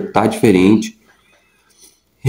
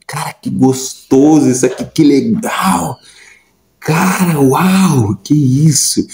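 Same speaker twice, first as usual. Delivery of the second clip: thought replayed higher and slower, a touch of wonder; retold inner delight; 4 semitones higher, slower than usual. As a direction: relive the rush of joy, lightly. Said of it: "a pensar comigo mesmo". (encantamento contido, pensando consigo, mais agudo e lento)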